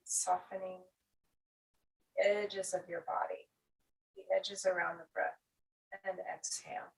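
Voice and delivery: calm, measured breathwork cues with long pauses; guided breathing. Acted. Teacher guiding students through inhale and exhale, guided breathing. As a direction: lead the breath in short, unhurried cues.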